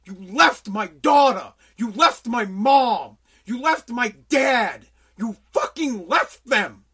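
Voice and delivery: loud, slow accusing voice of the families; slow, heavy, furious blame; grieving parents' fury. Retold or imitated. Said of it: "they get".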